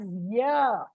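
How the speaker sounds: Y as "yuh"; letter sound. Shown as a demonstration. Teacher showing how J is pronounced, Y as yuh. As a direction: say Y as the sound that starts yes. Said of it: "pronounced as Y".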